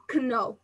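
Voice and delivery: "Kno" with the silent k sounded; mispronounced "Kno". Shown as a demonstration. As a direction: say "Kno" wrongly, with a k before the n. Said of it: "basic problem of Turkish students".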